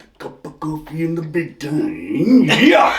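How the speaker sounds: raspy, drawn-out promo building to shout; Randy Savage impression. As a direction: growl it like Macho Man, then yell. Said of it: "the best interview for Randy Savage".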